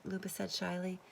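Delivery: ordinary delivery, nothing marked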